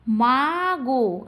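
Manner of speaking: two long drawn-out syllables; separate syllables. Shown as a demonstration. Teacher showing syllables are separate, slow drawn-out reading. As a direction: hold each syllable apart from the next. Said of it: "আলাদা আলাদা হচ্ছে কি না".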